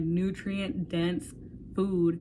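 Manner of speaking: usual speaking voice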